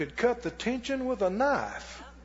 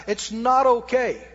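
slow, firm, word by word; said real slow; 2 semitones higher, 4 dB louder than usual. Shown as a demonstration. Preacher telling the congregation slowly and firmly. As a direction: drag out each word to make it sink in. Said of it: "I'm gonna say that real slow".

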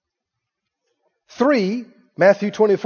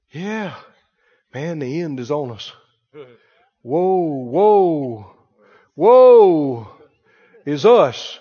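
low, mournful imitation of doomsayers; doom-laden preacher tone; 7 semitones lower, much slower than usual. Preacher mimicking people stuck on end-times signs, doom-laden. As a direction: intone it low, dragging out each woe. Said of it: "you hear that tone in their voice".

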